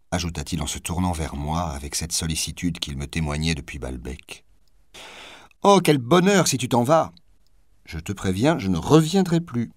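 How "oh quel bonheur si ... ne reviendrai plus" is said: mocking retort. A narrator's reading. Actress taunts her lover.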